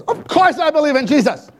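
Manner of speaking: high, loud imitated irritation; mock-upset father, loud and high. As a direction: snap it back at her. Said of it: "now he's really upset".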